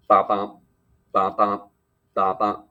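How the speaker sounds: loud, punchy heartbeat imitation; pretend heartbeat. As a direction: thump out a steady two-beat pulse, loudly. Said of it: "This is me pretending that I'm a heartbeat".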